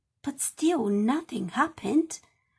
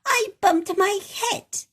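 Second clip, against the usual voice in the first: higher, louder sulky rabbit voice; cross little voice; 8 semitones higher, 4 dB louder than usual. Acted. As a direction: grumble it like a cross little girl. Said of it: "A rather cross little voice".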